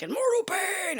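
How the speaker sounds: swooping very high, falling on mortal; mock melodrama. Mocking her own first session, very high hammed-up wail. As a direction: ham up the agony. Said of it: "and I'm like".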